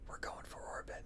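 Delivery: hushed dramatic whisper. Acted. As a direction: breathe it out secretly. Whispering the mission name.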